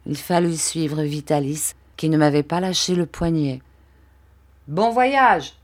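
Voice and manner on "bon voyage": high exclaimed send-off; called-out farewell. A narrator's reading. Barberin sends the boy off, calling out. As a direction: cry it out, voice raised. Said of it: "s'écria Barberin".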